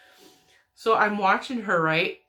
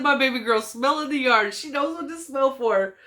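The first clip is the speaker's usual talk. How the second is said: high, loud re-enacted boast; proud gushing; 7 semitones higher, 4 dB louder than usual. Aunt boasts of her yarn-smelling baby niece, proud and excited. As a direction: gush it proudly, pitched up. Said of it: "I was so proud".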